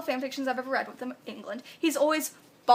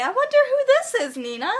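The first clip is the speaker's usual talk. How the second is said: British gentleman, high and loud at first, then dropping; lightly voiced British gentleman's accent; 9 semitones higher, 5 dB louder than usual. A narrator's reading. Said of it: "a very British gentleman say".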